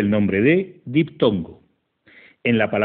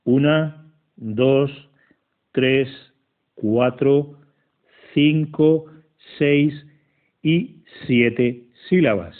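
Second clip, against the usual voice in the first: each number set apart by a pause, slow and deliberate; counting syllables aloud; much slower than usual. Shown as a demonstration. Teacher counting the verse's syllables for students, slow and deliberate. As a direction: tick off every syllable one by one.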